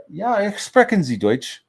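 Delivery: high, loud mock-German question. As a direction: ham up the German, rising at the end. Showing off remembered German.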